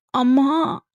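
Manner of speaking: a high sudden cry of passion; emotional cry. A narrator's reading. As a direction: cry out, overwhelmed. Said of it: "உணர்ச்சி வேகத்தில் கத்தி". (உணர்ச்சியில் கத்தல்)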